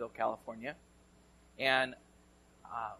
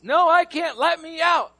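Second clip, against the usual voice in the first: mock trapped yell; 14 semitones higher, 12 dB louder than usual. Trapped friend yelling back.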